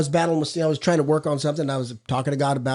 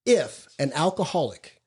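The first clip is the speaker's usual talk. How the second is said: measured, deliberate reading from the book; reading aloud; slower than usual. Acted. Speaker reads the Big Book passage to the group, measured. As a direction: read the passage slowly and weightily.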